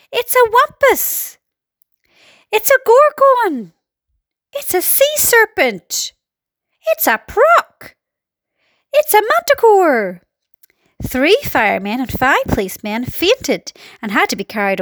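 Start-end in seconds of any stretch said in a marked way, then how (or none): 0.1-10.2 high, drawn-out cries of alarm; a crowd crying out one monster after another; panicked shouting. A narrator's reading. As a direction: stretch each alarmed guess up high. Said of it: "all started shouting at once".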